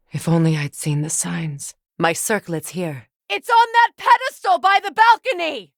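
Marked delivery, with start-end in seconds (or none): 3.3-5.7 calling out. Acted. Spotting the circlet, calling out.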